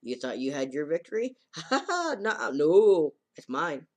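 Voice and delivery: high giggling taunt with a cackle; mock giggle, shrill at the laugh; giggling tease. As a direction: gloat and giggle like a smug girl. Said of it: "she's, like, giggling to herself".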